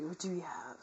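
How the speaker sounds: normal talking voice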